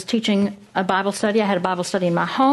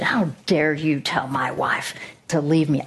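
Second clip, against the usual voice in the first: retold angry man; 6 semitones lower than usual. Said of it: "He was so angry with me".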